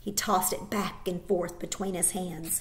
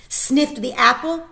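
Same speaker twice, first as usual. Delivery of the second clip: ordinary delivery, nothing marked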